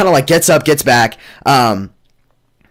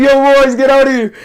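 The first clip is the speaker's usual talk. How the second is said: yelled call; 11 semitones higher, faster than usual. Retold or imitated. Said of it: "I was like".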